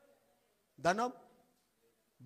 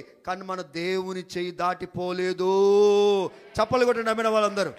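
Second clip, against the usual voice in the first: slow, loud drawn-out hallelujah cry; preaching climax; 18 dB louder, much slower than usual. Acted. Pastor calling for claps, slow loud climax. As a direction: stretch it out as the peak of the sermon.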